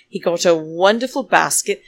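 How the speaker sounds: ordinary talk, nothing marked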